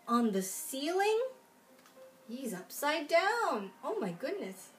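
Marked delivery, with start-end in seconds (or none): none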